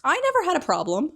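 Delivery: high, loud indignant yell. Retold or imitated. Arguing in an empty classroom, a high loud yell. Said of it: "They're, like, yelling".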